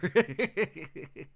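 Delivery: real amused laugh; bursting into laughter. Real, not acted. Laughing at lawyer quote.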